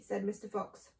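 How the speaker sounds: plain reading voice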